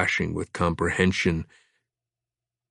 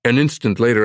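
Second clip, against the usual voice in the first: usual speaking voice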